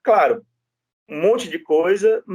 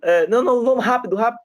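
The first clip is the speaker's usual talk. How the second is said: higher, insistent mock of the opposing lawyer; imitating a pushy lawyer cutting in, higher; pushy pressuring lawyer; 9 semitones higher, faster than usual. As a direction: press him to hurry up and skip the reading. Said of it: "fez aquela pressão".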